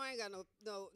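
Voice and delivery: ordinary delivery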